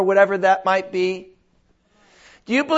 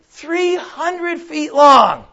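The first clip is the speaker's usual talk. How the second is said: slow, high, shouted "Three hundred feet long"; spelling out the size to his wife; emphatic Noah; 6 semitones higher, much slower than usual. Acted. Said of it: "He said".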